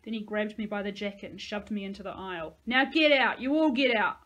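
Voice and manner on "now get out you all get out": harsh order. Acted. Thug orders kids off bus.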